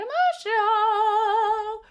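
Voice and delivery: high, loud, slow mock wail; mock emotional wail. Streamer hamming up tears to chat. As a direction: ham up getting teary. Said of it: "if I keep talking about it more".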